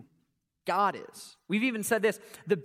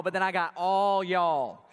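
slow Southern drawl on all y'all; slowed Southern accent; 2 semitones lower than usual. Shown as a demonstration. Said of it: "when we say all y'all".

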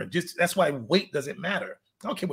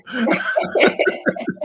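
breathy laugh; 6 semitones higher, 5 dB louder than usual. Real, not acted. Laughing at own joke.

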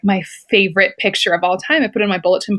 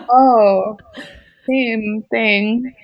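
ordinary talk

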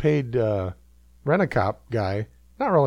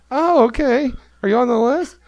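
higher, louder bright hostess; mock hostess voice; 11 semitones higher, 5 dB louder than usual. Hostess questioning Eric at the door, mock hostess voice. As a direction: play the hostess asking politely.

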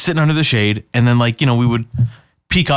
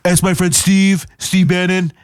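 lightly voiced Trump, name-dropping proudly; Donald Trump voice; 5 semitones higher than usual. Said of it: "That's how you do a Donald Trump impression".

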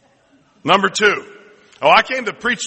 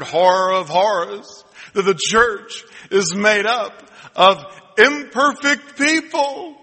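hammed-up horror, climbing at the end; mock horror; 3 semitones higher, slower than usual. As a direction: draw it out as a shocking discovery. Mock scandalized discovery.

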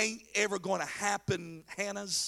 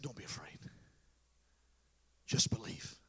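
very soft, low, near-whispered comfort; calm reassurance; 12 semitones lower, 9 dB quieter than usual. Acted. Jesus comforts grieving Jairus, softly and calmly. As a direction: hush it right down to soothe.

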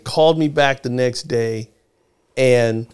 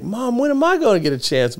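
put-on higher-pitched little-kid complaint; pleading child; 10 semitones higher than usual. Youngest child asks mom for a chance, pleading high. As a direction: imitate a bummed-out youngest child. Retells himself as bummed-out child.

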